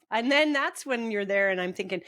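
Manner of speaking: usual speaking voice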